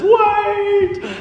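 wife shouts high and sharp to stop him; calling out. Retold or imitated. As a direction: yell wait to stop the car. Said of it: "and Allison says".

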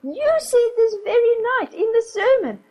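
put-on high, ringing cry of a girl, near tears; a little girl crying out in protest. A narrator's reading. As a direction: proclaim it, eyes flashing. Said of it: "her voice rang out".

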